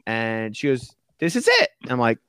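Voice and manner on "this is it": high, loud excited announcement; excited wife. Retold or imitated. Wife springing the fake house news, high and excited. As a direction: chirp it out gleefully.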